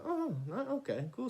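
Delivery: high, breezy pretend-cool; mock casual surprise. Reacting as she sits down, high and breezy. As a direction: act unbothered but caught off guard. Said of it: "I'm like".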